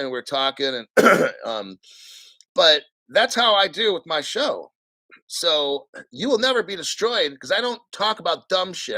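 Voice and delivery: normal talking voice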